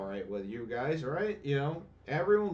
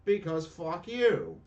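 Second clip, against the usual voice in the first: higher, sharp angry retort; angry curse; 6 semitones higher, 4 dB louder than usual. Real, not acted. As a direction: spit it at the game. Cursing the game.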